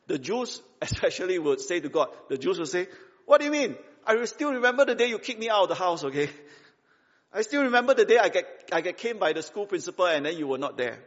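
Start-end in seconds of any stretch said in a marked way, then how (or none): 3.3-11.0 loud, higher-pitched accusation; indignant protest. Acted. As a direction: hurl old grievances at a parent. Acted grievance against God as parent.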